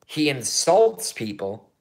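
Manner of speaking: ordinary talk, nothing marked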